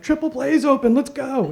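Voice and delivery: put-on high, excited kid voices; eager kids. Boys rushing their friend to the first ride, high and excited. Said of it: "Peter and Aaron are like".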